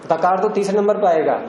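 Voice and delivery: normal talking voice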